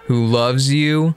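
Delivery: normal talking voice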